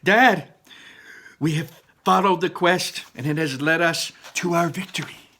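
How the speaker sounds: high, loud triumphant declaration. Acted. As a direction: announce the victory proudly. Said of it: "said Ian".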